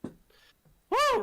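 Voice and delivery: high, loud whoop; excited whoop. Real, not acted. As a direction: cheer it out high and bright. Co-host cheering at show opening.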